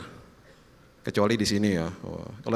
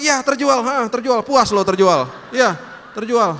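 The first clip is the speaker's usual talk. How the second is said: grudging confirmation; 10 semitones higher, 6 dB louder, slower than usual. Acted. Father answers child, grudging about sale.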